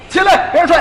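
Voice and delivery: high, loud barked order; angry yell. Retold or imitated. Master punches the boy and yells at him to get up.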